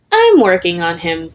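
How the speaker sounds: usual speaking voice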